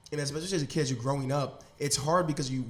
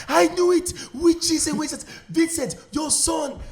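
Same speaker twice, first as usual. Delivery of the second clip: much higher and louder Nigerian mom shout; furious mom yell; 11 dB louder than usual. Retold or imitated. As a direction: catch the kid with the book and shout. Mom catches him, yelling.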